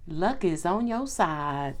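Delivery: slow, soft Southern US accent. Real, not acted. Reading tarot to viewers in a soft Southern US accent. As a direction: let a Southern US drawl slip in, softly. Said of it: "my southern accent comes out".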